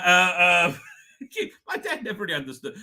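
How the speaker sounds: ordinary talk, nothing marked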